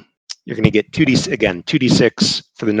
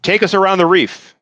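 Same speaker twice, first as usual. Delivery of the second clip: raised, commanding captain's orders; ship captain commanding; 6 semitones higher than usual. Acted. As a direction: bark orders like a ship's captain. Captain giving orders to crew.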